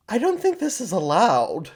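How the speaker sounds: high-pitched scandalized objection; hammed-up disbelief; mock shock. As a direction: react as if appalled by what you watched.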